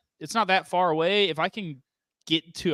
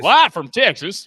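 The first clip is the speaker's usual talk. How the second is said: news anchor voice; 4 semitones higher, 8 dB louder than usual. Pretend. Repeats mock news intro, louder.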